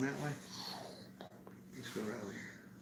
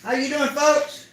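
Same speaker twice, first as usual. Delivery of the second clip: upbeat, higher, slow greeting to viewers; vlog host intro; 6 semitones higher, 4 dB louder than usual. Acted. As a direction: welcome the viewers like a show host.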